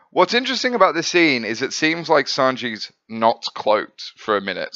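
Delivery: ordinary delivery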